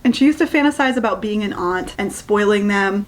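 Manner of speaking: ordinary talk, nothing marked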